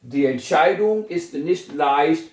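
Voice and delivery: whole sentence very slow; slow full sentence. Shown as a demonstration. Teacher reading the finished sentence slowly to class. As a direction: read it slowly for copying. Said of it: "फैसला जो है आसान नहीं है".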